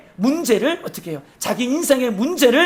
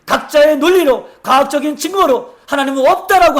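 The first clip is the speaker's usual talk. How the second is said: raised, driving sermon voice, higher and louder; fiery preaching; 5 semitones higher, 6 dB louder than usual. Acted. Pastor warning the church about the last days, fiery preaching. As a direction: preach it out to the congregation.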